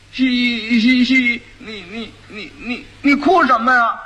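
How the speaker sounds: dim-witted groom getting stuck on words; stammering fool. Acted. Fool haltingly addresses crying bride.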